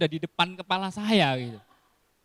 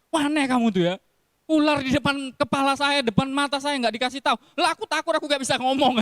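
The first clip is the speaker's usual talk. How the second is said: mock scolding; 7 semitones higher, 3 dB louder than usual. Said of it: "Terus saya marah sama dia".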